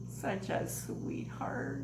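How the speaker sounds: slow, hushed gratitude with a sigh; moved whisper. Real, not acted. Vlogger thanking Bridget for her gift, touched whisper. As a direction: breathe out heartfelt thanks, overwhelmed.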